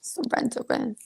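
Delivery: hushed, playful surrender; mock surrender. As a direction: yield to God with comic resignation. Acts giving in to God.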